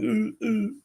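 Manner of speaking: put-on high-pitched baby cooing; imitated baby sound. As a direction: coo like a newborn just woken up. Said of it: "you can hear the baby sound".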